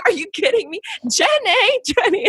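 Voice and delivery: high, incredulous calling of the name; exasperated disbelief. Retold or imitated. Forrest Gump fan begging the kids at Taboo, exasperated. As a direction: plead with them in disbelief. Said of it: "I was like".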